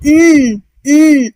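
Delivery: उम as a long held "nnn"; sustained, isolated "nnn" for उम; N letter sound. Shown as a demonstration. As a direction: hold the N sound twice for उम, उम. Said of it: "एन का sound क्या है?".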